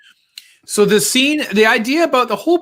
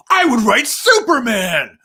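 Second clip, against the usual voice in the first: high, drawn-out mock-angry shout; hammed-up angry kid imitation; 6 semitones higher, 4 dB louder, slower than usual. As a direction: overact a furious child, slowly and emphatically. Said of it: "he was a very angry kid".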